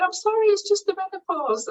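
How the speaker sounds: high-pitched mock weepy apology; imitated tearful apology. Embarrassed interviewee apologising to the man opposite, imitated tearfulness. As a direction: apologise as if still crying, half in jest. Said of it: "And I couldn't stop crying".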